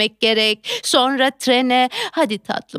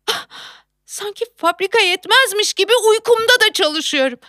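ordinary talk

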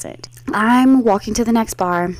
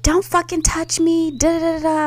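put-on drunk sister voice; 9 semitones higher than usual. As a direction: push him off like a drunk. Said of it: "She's like".

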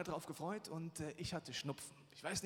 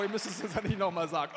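usual speaking voice

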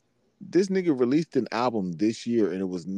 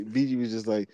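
ordinary talk

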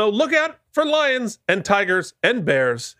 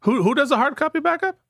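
surprised; 8 semitones higher, faster than usual. Real, not acted. Indignant retort.

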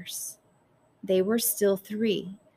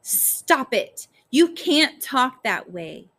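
raised, higher-pitched protest; yelling; 5 semitones higher, 6 dB louder, slower than usual. A narrator's reading. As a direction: cry out in protest at a parent. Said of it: "Early yelled at her mother".

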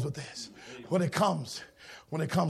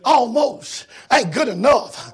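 high sermon shout, building to a roar; rising, shouted pulpit climax; full-force preaching; 12 semitones higher, 13 dB louder than usual. Acted. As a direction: drive each repeat harder.

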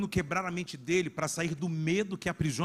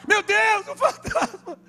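shrieking mock fright, much higher; terrified disciples; 9 dB louder, slower than usual. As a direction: play the scared disciples seeing a ghost. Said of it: "e falaram o quê?". (discípulos apavorados com o fantasma, grito agudo de susto)

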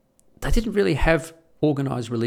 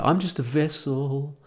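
slow, drawn-out mystic tone; a lightly voiced New Age guru; mock New Age guru; 5 semitones higher, slower than usual. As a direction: lay on the spiritual guru act. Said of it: "Like every fucking New Age wanker".

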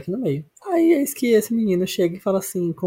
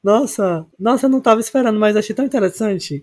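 mock excitement; 7 semitones higher, 4 dB louder than usual. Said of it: "sarcastic mock excitement".

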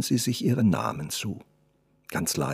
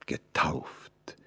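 a low, much softer whisper of "Getauft?"; astonished whisper; 6 semitones lower, 11 dB quieter than usual. Acted. The artist repeats her word to her, in a hushed, wondering whisper. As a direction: breathe the word in quiet wonder. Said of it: "wiederholte er fast staunend".

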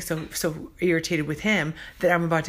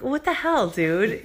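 incredulous; 5 semitones higher, slower than usual. Retold or imitated. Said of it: "you're like".

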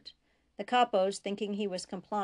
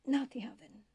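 breathless whisper, higher and pleading; whispered plea; 5 semitones higher than usual. A narrator's reading. Dying prisoner begs Bashir once more, whispering. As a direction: barely breathe the words, begging.

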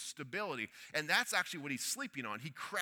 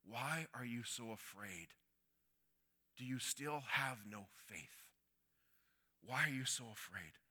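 soft, slow, lower questioning; gentle question; 5 semitones lower, 7 dB quieter than usual. Acted. Jesus gently asks his frightened disciples, soft and slow. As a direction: ask it tenderly, slowly.

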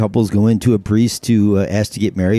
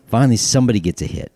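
ordinary talk

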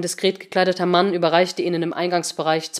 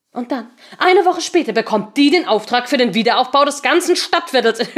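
angry; 4 semitones higher, 5 dB louder than usual. Acted. Seemann reading out accusations, furious.

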